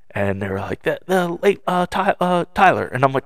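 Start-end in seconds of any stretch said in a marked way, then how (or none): 0.8-2.9 higher-pitched retold hesitation; halting, raised-pitch quote; retold searching for a name. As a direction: imitate someone fishing for a name. Voicing staff groping for name.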